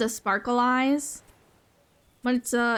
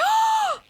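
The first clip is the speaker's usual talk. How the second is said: delighted gasp; 5 dB louder than usual. Real, not acted. Delighted at unlocked title.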